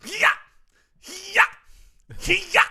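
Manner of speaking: short, sharp "hya" grunt; explosive, high mock tennis grunt; strong grunt. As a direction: grunt like a martial arts warrior. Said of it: "Her grunts now sound like this".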